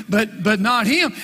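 ordinary talk

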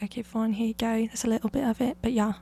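a little British accent shown to listeners. Shown as a demonstration. Podcaster shows her childhood British accent, lightly done. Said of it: "I would always talk in a British accent".

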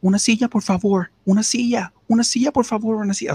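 high pleading repeated cry for a seat; imitating the man on the bus begging for a seat; whiny seat beggar. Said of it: "él empezaba a decir".

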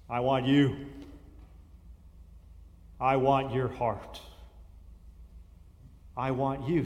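low, soft and tender; hushed loving plea; tender God voice. Acted. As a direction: draw the listener in gently. God's tender plea in sermon.

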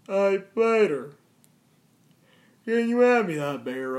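normal talking voice

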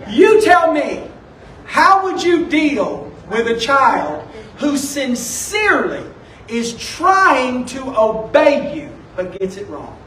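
ordinary delivery

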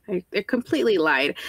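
usual speaking voice

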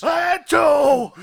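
very loud, high, slow shout; big captain shout. Acted. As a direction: bellow it, drawing each word out.

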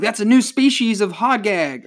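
hammed-up announcement of a discovery; mock proud discovery. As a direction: imitate someone announcing an amazing find. Acting believers announcing a find.

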